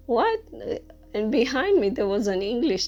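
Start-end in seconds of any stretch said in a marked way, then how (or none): 0.1-0.4 high squeaked disbelieving "What?"; a sharp, high, astonished "What?"; shocked what. Retold or imitated. Teacher shocked at being called a student, high disbelieving "What?". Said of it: "I, I was like".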